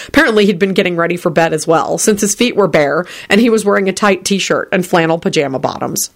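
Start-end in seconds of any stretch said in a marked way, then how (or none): none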